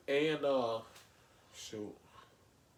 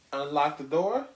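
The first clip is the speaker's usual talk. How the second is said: ordinary delivery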